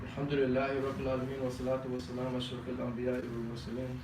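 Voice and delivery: fast, low murmured Arabic recitation; recited prayer. Acted. Imam reciting the khutbah formula to the congregation, quick and hushed. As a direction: rattle off the formula quickly and softly.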